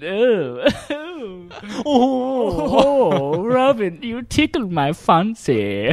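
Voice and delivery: high, slow, giggly mock superhero; hammed-up coy delight, drawn out; camp Batman parody. Batman flirting with Robin, high hammed-up camp voice.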